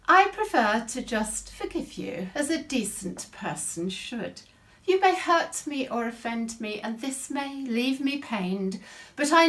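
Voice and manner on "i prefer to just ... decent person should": usual speaking voice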